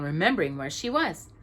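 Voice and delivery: ordinary delivery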